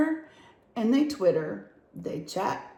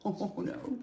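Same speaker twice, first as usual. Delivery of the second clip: hushed worried gasp, much softer; 7 dB quieter than usual. Acted. Breathe it out in dread. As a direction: animals fear waking the bear, hushed dread. Crowd freezes as bear wakes.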